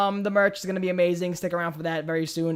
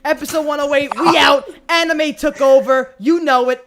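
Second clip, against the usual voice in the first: loud, high announcer sign-off; host signing off the episode loudly; hype outro; 6 semitones higher, 9 dB louder than usual. Acted. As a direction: sell the ending big.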